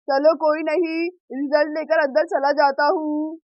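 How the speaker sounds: a troubled dad dreading the news; low-voiced father muttering his worry; worried father. Father voiced returning with results.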